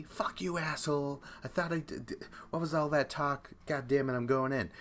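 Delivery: mock indignation. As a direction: imitate an indignant sputtering outburst. Retold outburst of indignant Matt.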